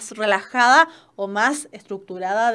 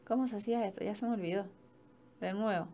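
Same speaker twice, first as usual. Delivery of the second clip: much softer, talking under her breath; muttering to herself; 2 semitones lower, 15 dB quieter, faster than usual. Real, not acted. Teacher fiddling with her slides, muttering quietly to herself. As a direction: mumble it as a private aside while fumbling.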